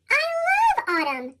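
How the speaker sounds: high, bright-eyed delight; a kid beaming over his favourite season; happy child. A narrator's reading. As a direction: gush about autumn, lifted up high. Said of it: "Pete says".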